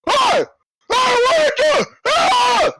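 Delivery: shrieking high panicked yells; burning giant's panic. Acted. Giant guard on fire yelling at the bear, high panicked screams. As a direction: scream as your clothes catch fire. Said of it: "And he goes".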